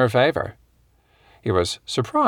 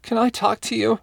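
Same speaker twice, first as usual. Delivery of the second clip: high and wobbly, near tears; pitched high, voice wavering on the edge; 12 semitones higher than usual. Acted. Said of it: "in a dangerously high and wobbly voice".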